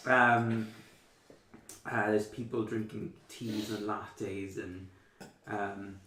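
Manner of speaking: ordinary delivery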